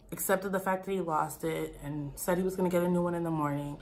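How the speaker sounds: ordinary talk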